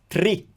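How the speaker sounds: "Tri" with clean t and rolled r; Italian TR. Shown as a demonstration. Teacher asking learners to hear the difference, "Tri" with rolled r. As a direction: roll the r straight after t in "Tri". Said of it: "Can you hear how it's different?".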